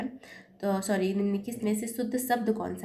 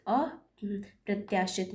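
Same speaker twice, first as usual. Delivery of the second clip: अप्रत्याशित drawn out slowly; slow emphatic answer; much slower than usual. Shown as a demonstration. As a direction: spell out अप्रत्याशित slowly for the class. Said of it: "सही उत्तर है". (उत्तर को धीरे-धीरे बोलें)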